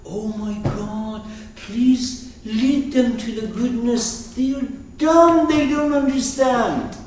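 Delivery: impassioned high-pitched prayer; crucified Jesus pleading. Acted. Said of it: "he will say".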